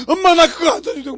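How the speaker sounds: put-on a high, loud crying boy's plea; crying child. Lost boy begging his mother not to leave, high teary plea. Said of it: "그때서 뭐라 그러죠?".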